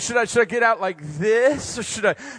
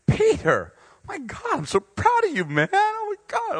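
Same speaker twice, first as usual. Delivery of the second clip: much higher, overjoyed gushing praise; gushing Jesus; 12 semitones higher, 3 dB louder than usual. Pretend. Preacher voicing Jesus gushing with pride at Peter. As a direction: gush like a proud friend near tears. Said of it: "would have at least encouraged him. Like".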